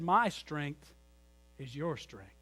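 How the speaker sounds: soft, low fatherly reassurance; a quiet loving dad; tender father. Retold or imitated. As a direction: gently let it sink in. Father's tender answer to son.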